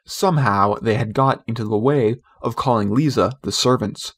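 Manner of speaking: plain reading voice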